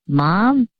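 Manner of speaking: ordinary talk, nothing marked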